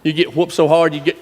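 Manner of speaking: ordinary talk, nothing marked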